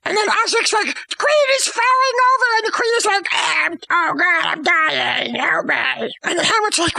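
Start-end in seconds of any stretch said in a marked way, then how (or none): 1.1-2.5 high, urgent acted cry; hammed-up shout of alarm; mock alarm. Osric warns that the queen is falling, mock alarm. 3.3-6.1 drawn-out wail sinking in pitch; hammed-up death moans; mock dying wail. Poisoned queen begs for help, mock dying wail.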